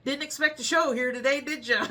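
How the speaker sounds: light joking jab at the customers; joking tease. Retold or imitated. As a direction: tease them with a grin. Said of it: "I'm a joking person anyway".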